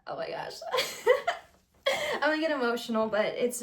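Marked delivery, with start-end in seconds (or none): 0.1-0.5 choked up. Real, not acted. 1.9-3.1 fast and laughing through tears. Real, not acted. Telling viewers about tarot, laughing through emotion.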